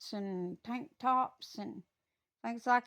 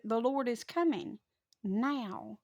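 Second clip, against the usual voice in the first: usual speaking voice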